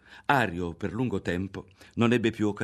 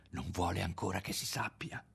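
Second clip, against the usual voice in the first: much softer, confiding secret; lowered voice; 8 dB quieter than usual. A narrator's reading. Ario to Lidia, lowering his voice about his mother's secret. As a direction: drop your voice as if sharing a secret. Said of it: "abbassò la voce".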